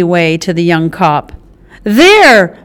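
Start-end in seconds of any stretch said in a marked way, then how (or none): 1.8-2.6 a loud, high shout. Acted. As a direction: call it out while pointing. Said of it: "Blue shouted".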